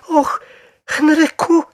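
very high, loud, startled cry; exclaiming. A narrator's reading. Wife gripping her husband's arm at the sight, astonished. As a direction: cry out with pitch shooting up. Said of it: "zawołała".

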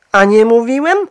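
high, loud, triumphant question; proud. A narrator's reading. Husband to doubting wife, smugly triumphant. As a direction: crow over being proved right. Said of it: "z dumą oznajmił pan Brown".

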